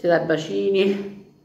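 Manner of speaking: talking while biting food; muffled words around food; mouth full of pizza. Real, not acted. Seen 2: Lifts pizza slice to mouth and bites into it while speaking.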